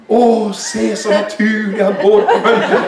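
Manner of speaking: hammed-up awestruck marveling; mock admiration. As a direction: gush in a put-on, sarcastic wonder. Mocking imagined awestruck disciples.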